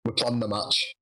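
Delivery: normal talking voice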